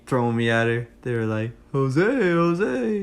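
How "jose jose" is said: high, loud sing-out of the name; mock taunting call, pitched way up; teasing friends. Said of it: "My friends were teasing me".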